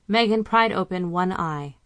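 ordinary delivery

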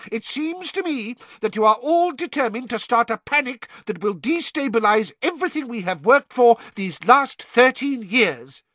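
high, loud and angry; angry minister. A narrator's reading. Fudge angrily accusing them of panic, high and loud.

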